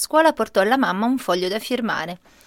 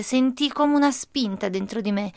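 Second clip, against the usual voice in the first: ordinary delivery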